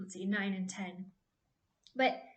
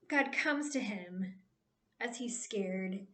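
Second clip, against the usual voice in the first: ordinary talk, nothing marked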